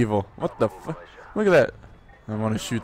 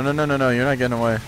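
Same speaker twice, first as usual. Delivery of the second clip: normal talking voice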